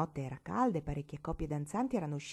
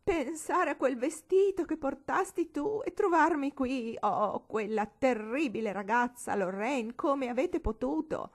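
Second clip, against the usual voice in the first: higher, louder, tearful accusing lament; tearful reproach; 7 semitones higher, 3 dB louder, slower than usual. Acted. Old lady blaming Lorraine, tearful accusing wail. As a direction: reproach Lorraine through sobs.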